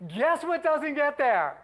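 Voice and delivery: teasing setup. Real, not acted. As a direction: dangle the question slowly. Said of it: "teasing the reveal".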